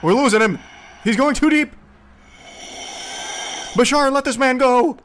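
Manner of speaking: high, loud hammed-up alarm; urgent fake-panicked calling out; mock panic. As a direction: play an exorcist losing his patient. Mock rescue of channeler from possession.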